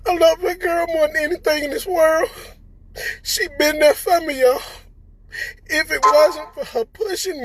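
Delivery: real crying, each word strained; choked up with love and gratitude. Real, not acted. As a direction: voice high and breaking with tears. Tearful tribute to his wife.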